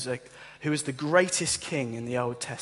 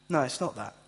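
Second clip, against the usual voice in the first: ordinary talk, nothing marked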